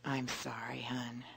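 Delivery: soft whispered sympathy; tender comfort. Shown as a demonstration. Comforting a hurting friend in a soft, tender whisper. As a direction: breathe it out gently to someone hurting. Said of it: "there's love in that tone".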